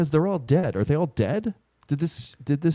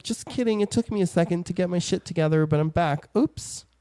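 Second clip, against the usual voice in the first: high, fast, breezy mock voice; flippant Jean Grey; 6 semitones higher than usual. Cohost voicing Jean Grey returning from death, high and breezy. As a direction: toss it off like nothing happened.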